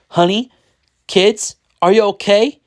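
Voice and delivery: high, questioning worried voice; nervous. A narrator's reading. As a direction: call to each one anxiously, voice rising. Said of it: "he asked nervously".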